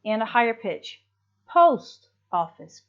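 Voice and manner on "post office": Post office, POST punched louder and slower; stress on first word. Shown as a demonstration. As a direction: lean hard on Post, let office fall away. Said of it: "the first word has the heaviest stress".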